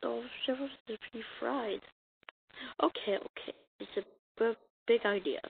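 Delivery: normal talking voice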